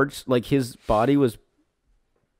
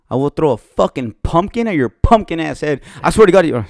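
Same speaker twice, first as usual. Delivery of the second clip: mock angry; 4 semitones higher, 6 dB louder than usual. Mock threat over dog name.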